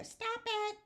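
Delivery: hammed-up annoyed child whining stop it; mock angry daughter. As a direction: play the exasperated daughter. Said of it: "my daughter would get so mad".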